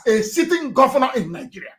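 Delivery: normal talking voice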